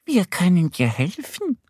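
high, squeaky little duck; high cartoon duck; duck character voice. A narrator's reading. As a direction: offer help as an eager little duck. Duck Annie offers help.

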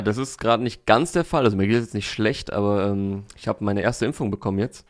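ordinary talk

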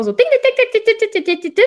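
high, loud ding ding ding; ping out each ding like a buzzing brain; mock neuron pinging. Shown as a demonstration. Mother imagines overactive neurons firing, pinging high. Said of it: "ich stell mir das mal vor".

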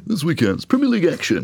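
hammed-up high telly announcer; TV presenter voice. Shown as a demonstration. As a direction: overdo the sports presenter link. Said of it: "I sound like some geezer on the TV".

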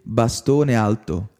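Scambetto spelled out slowly in an Italian accent; hesitant Italian pronunciation, read slowly; careful Italian. Shown as a demonstration. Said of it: "Jetzt wird's schwierig für mich".

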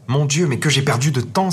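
a mild alarmed exclamation; dismayed cry. A narrator's reading. Gerda notices autumn has come, mild dismayed cry. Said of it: "s'écria la petite Gerda".

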